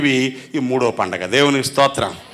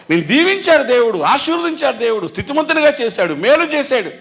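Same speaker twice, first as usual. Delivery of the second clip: excited; 9 semitones higher than usual. Real, not acted. Preacher exults in blessings.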